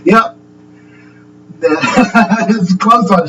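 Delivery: laughing through the words, slowed. Real, not acted. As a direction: chuckle as you say it. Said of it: "you can tell I'm having fun now".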